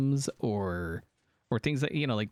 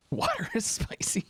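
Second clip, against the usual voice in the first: laughing; 12 semitones higher than usual. Real, not acted. Laughing at a chat remark.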